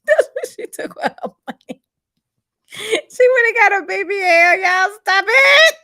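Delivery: high, squealing through real laughter; cracking up laughing. Real, not acted. Host begging viewers to stop the gossip, helplessly laughing. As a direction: barely get it out for laughing.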